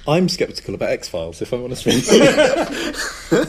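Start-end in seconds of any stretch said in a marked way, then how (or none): 1.4-3.4 laughing. Real, not acted. Laughing admission.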